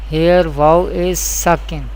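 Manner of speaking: ordinary delivery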